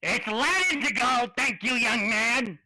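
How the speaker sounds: put-on old gnome shopkeeper. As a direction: scold the boy like a fussy shopkeeper. GM voices the shop gnome.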